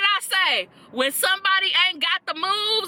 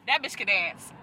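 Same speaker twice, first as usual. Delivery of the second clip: low, softer aside; understated; 6 semitones lower, 6 dB quieter than usual. Real, not acted. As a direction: say it low, almost to yourself. Repeats praise, low then exclaimed.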